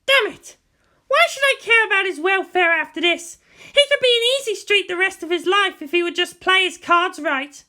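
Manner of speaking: put-on a proud girl cursing to herself; teenage girl's inner voice. Pilot girl fuming inwardly over the boy.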